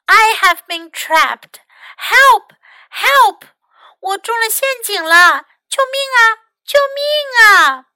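loud, high cries for rescue; acted distress, pitched far up and loud; calling for help. A narrator's reading. Trapped mouse calling out for help, loud and high.

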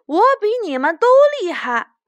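fast, high, loud gloating; smug boast. Acted. Winning rabbit gloating to the other rabbits, smug boast. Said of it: "他突然觉得好得意".

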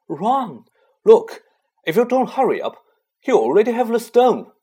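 high, loud, pressing argument; urgent insistence. Acted. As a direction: urge your friends to act before it's too late. Urging friends mid chess game.

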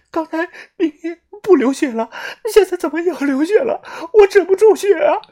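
high, tearful panicked plea; crying. A narrator's reading. Xiaoxuan presses the bleeding wound, crying in panic. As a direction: cry out through tears. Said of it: "哭着说道".

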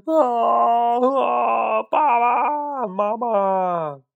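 high, drawn-out frightened wail for mom and dad; lost little chick wailing for its parents; scared crying out. A narrator's reading. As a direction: cry out like a lost, terrified chick. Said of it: "又急又怕地哭喊起来".

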